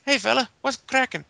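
a cheerful Irish brogue, badly faked; bad Irish accent. Mocking Sheamus to the podcast hosts in a bad Irish accent. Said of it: "This is not a very good Irish accent".

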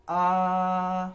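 put-on a long flat high आ like a child opening its mouth for food; first tone. Shown as a demonstration. Teacher models first tone आ, held level and high. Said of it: "बिल्कुल straight tone है".

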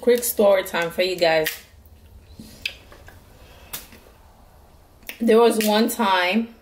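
talking between bites; muffled chewing speech; mouth full while chewing. Real, not acted. Seen 3: Bites crab at -2; lips pressed, chewing motions during words.

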